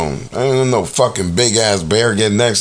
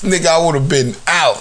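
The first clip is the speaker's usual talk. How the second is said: ordinary delivery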